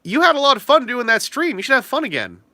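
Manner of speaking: high bright cheerful prod; excited nudge. Shown as a demonstration. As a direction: poke him cheerfully, high. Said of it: "this is how you do it".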